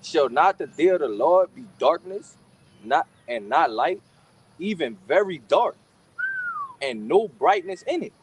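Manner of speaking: slow, drawn-out verse reading; scripture reading. Real, not acted. Reading about the day of darkness, slow emphatic scripture reading. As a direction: stretch each phrase and pause after it.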